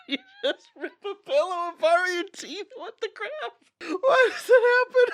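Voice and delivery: very high squeal through tearful laughing; laughing so hard it sounds like crying; real hysterical laughter. Real, not acted. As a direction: lose it completely, voice squeaking in disbelief. Losing it laughing over the pillow.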